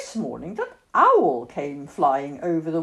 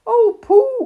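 high, loud cry of dismay; anguished high call; 11 dB louder than usual. A narrator's reading. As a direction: call out in distress for a lost friend. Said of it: "cried Christopher Robin".

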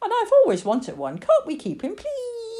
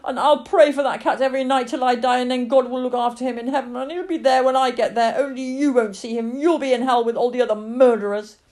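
put-on raised, loud child's outburst, building in force; screaming tirade; 7 semitones higher, 8 dB louder than usual. Lily screams at her father about the cat, impassioned fury. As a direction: hurl accusations, building to sustained fury. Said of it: "screamed at her father, tears pouring down her face".

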